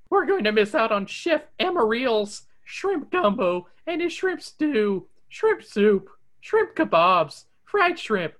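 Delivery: dreamy food list in Shaggy voice. Acted. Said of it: "What is this, Forrest Gump?".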